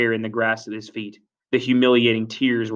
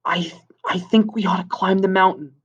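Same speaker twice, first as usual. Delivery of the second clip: put-on high, hesitant boy's voice; nervous suggestion; 8 semitones higher than usual. Simon turns to Piggy, high nervous stammer. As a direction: stumble into it, then venture the idea.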